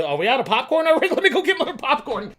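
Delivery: laughing mock-casual exit; awkward escape. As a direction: bail out of the argument. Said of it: "you're just sitting there like".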